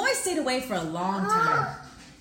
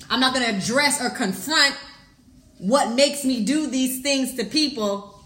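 loud, driving sermon delivery; fired-up preacher mocking the boy; preaching; 2 semitones higher, 5 dB louder than usual. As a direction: preach it hard at the congregation. Preaching at full force.

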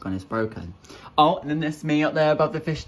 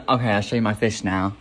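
ordinary talk

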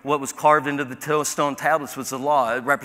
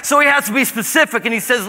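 normal talking voice

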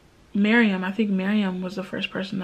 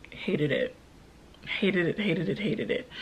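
quiet aversion; 2 semitones lower, 8 dB quieter than usual. Real, not acted. Vlogger recalling telling family of breakup, quiet aversion. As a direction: drop the voice and repeat it under your breath.

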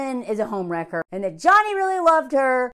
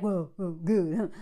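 mock smug man; 7 semitones lower, 5 dB quieter, slower than usual. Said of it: "he's like".

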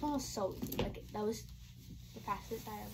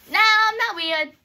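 high, loud denial; hammed-up outraged denial; mock indignant protest; 9 semitones higher, 13 dB louder than usual. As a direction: protest loudly at a raised pitch. Playful protest answering own question.

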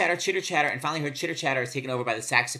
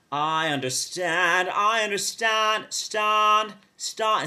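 flat fifties vowel in "understand", slow and higher; slow, higher fifties Broadway accent; 7 semitones higher, 5 dB louder, much slower than usual. Retold or imitated. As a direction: flatten "stan" toward an ah like fifties talk. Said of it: "a flattening of the vowel".

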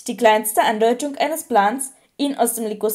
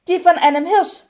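higher, mildly nervous answer; 6 semitones higher than usual. A narrator's reading. Harry answering Lupin's test question about his Patronus, nervous. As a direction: answer with a touch of nerves. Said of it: "sagte Harry nervös".

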